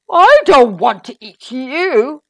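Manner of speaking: loud, slow, astonished giant; big indignant giant voice; gasping giant. A narrator's reading. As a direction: boom it out, drawn out and aghast. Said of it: "gasped the giant".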